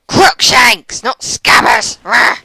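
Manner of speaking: loud, high self-scolding outburst ending in a growl of frustration; angry at self. Real, not acted. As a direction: shout the correction, furious at your own slip. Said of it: "So angry at myself".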